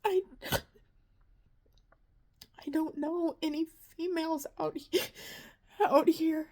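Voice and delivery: high, breaking voice with sobs between words; crying out each line, choking on the words; sobbing. Real, not acted. Vlogger describing her anxiety to viewers, sobbing. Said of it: "I didn't mean to get emotional".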